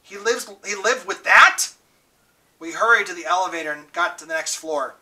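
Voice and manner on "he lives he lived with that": slow, higher, louder disbelieving question; choked with tears. A narrator's reading. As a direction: close to tears, stunned. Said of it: "There was a catch in Two-Bit's voice".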